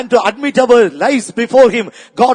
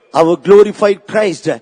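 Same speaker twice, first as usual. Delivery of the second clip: usual speaking voice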